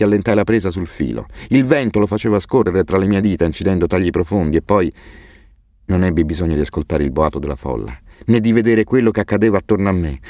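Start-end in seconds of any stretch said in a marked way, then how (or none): none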